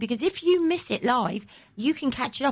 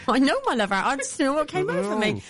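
put-on local Kent accent; 3 semitones higher than usual. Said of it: "You got very local all of a sudden".

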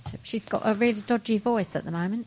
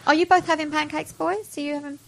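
raised voice, pitched up high; calling out; 6 semitones higher, 3 dB louder than usual. Real, not acted. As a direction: call across the studio to them. Calling out to colleagues.

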